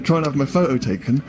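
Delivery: ordinary talk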